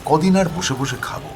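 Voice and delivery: plain reading voice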